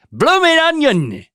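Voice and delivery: hammed-up exclamation. Son joking about Aussie food, loud and high. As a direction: belt it like a goofy ad.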